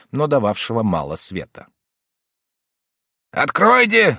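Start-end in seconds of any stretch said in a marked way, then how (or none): 3.3-4.2 high, loud pounding shout. A narrator's reading. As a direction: yell it at the locked door. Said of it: "закричал дядя".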